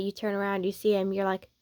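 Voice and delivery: usual speaking voice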